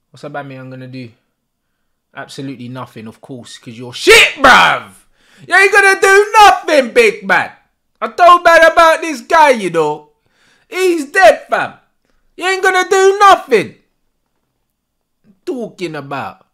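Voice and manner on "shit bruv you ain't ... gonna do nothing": much higher and louder jeering; mocking shouted taunt. Real, not acted. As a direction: jeer at the striker from the sofa. Taunting opposing striker.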